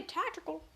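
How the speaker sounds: "tactical" said high as a stand-in for "theatrical"; wrong word for theatrical. Retold or imitated. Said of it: "no matter how wrong I said it".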